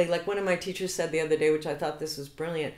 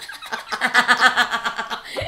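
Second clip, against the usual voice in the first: loud, high laugh at the shoe story; big burst of laughter; 5 semitones higher, 9 dB louder than usual. Real, not acted. Laughing loudly while telling the shoe story.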